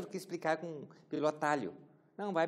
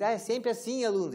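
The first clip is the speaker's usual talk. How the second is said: mock teacher; 7 semitones higher, 5 dB louder than usual. Imitating a teacher's rule.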